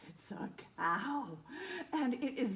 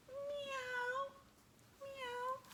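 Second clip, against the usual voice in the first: high meowing puppy; cat meow; 5 dB quieter than usual. Acted. Puppy meowing at his mother, high and catlike.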